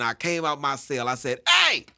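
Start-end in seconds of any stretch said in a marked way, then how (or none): 1.5-1.8 shouted high and loud across the room, fast; brash jailhouse announcement, loud and fast; loud call-out. Retold or imitated. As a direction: hype up the whole day room with a big loud joke. Loud joke to the day room.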